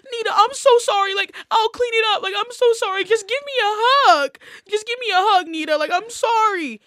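put-on high, loud pleading child voice; sorry little boy. Toddler nephew begs his cousin for a hug, scared and sorry. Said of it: "He was like".

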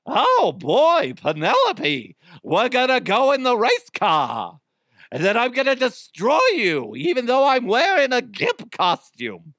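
high, loud cartoon bad-guy voice; Wreck-It Ralph villain. Villain taunting Penelope before the race, high and loud. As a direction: ham it up like a big goofy villain. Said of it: "he's like".

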